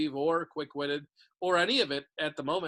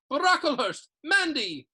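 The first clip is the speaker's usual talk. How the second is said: calling out names; 9 semitones higher, 8 dB louder, slower than usual. A narrator's reading.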